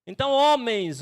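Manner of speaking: calling the men. Acted. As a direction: call out "homens" to grab their attention. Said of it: "se posicionem".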